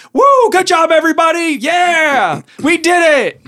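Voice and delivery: high-pitched, loud celebration; voicing cheers for everybody; cheering crowd. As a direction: whoop like a jubilant crowd. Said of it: "they're, like, cheering".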